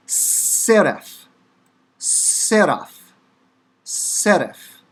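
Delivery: SERAPH with long hissed s and tapped r; Hebrew pronunciation demo. Shown as a demonstration. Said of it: "the Hebrew word for seraph".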